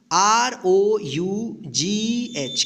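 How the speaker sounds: each letter spelled slowly; slow spelling. Shown as a demonstration. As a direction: spell R O U G H for the class. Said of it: "क्या होगा?". (धीरे-धीरे अक्षर)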